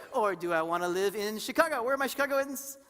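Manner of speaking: ordinary talk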